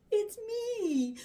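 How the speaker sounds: plain reading voice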